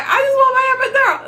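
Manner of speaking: high, fast desperate plea; mock frantic plea; begging. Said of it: "And I was like".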